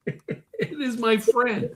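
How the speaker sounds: laughing it out, slow; chuckling through the words. Real, not acted. To her co-host about alcohol, said laughing.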